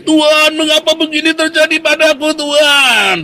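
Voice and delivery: high, loud mock wail to God; theatrical shouted lament; mock anguished cry. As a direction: cry out like an aggrieved believer, hammed up. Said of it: "Jangan teriak-teriak".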